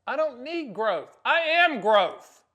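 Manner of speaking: slow, high, building to a shout; swelling, drawn-out declaration; grand boast. Real, not acted. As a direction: proclaim it loudly, word by word.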